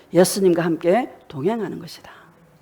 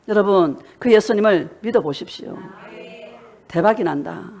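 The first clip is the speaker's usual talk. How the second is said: ordinary talk, nothing marked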